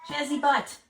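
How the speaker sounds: loud, high call to a pet; exasperated pet-owner call; calling the cat. Real, not acted. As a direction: raise the pitch and call out to a naughty cat.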